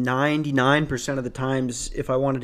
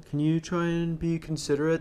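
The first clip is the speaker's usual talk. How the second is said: higher, softer mocking imitation; exaggerated prissy request, raised in pitch; mock snooty complainer; 4 semitones higher, 5 dB quieter than usual. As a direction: make them sound like a dick. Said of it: "make them sound like a dick".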